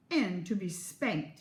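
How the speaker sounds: usual speaking voice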